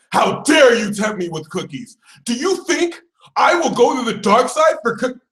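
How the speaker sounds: hammed-up scandalized protest; mock indignation. Sagittarius indignant at temptation.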